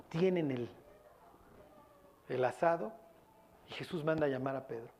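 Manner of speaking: ordinary talk, nothing marked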